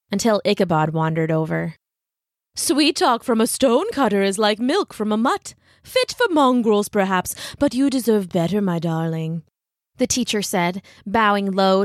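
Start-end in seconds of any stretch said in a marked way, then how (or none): 2.6-9.4 swooping, affected snob's voice; pompous schoolteacher. Acted. Ichabod cuts in on Brahm and Katrina, pompous swooping disdain. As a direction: sneer at the rival, then flatter the lady.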